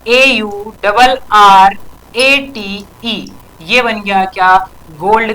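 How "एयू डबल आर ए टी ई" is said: very slow letter-by-letter spelling. Shown as a demonstration. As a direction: spell it out slowly, each letter stretched and separate. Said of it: "ऐसे".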